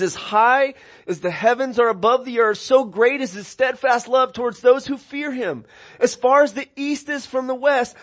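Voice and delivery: scripture reading. Acted. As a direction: read the psalm out with lifted voice. Preacher reads Psalm aloud.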